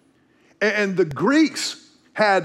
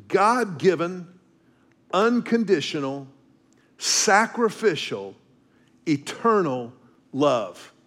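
ordinary delivery

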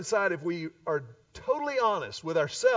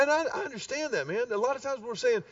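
ordinary delivery

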